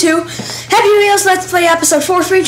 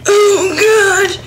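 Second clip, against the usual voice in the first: mock exclamation; 5 semitones higher than usual. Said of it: "it goes like".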